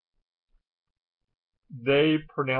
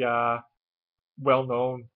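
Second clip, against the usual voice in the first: ordinary delivery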